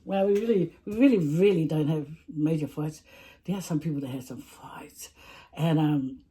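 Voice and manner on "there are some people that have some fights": whisper. Pretend. Juicy gossip whispered aside.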